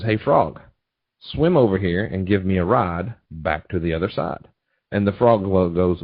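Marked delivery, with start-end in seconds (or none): none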